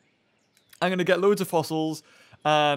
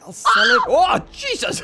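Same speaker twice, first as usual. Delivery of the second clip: startled shout; 7 dB louder than usual. Real, not acted. Startled by in-game event.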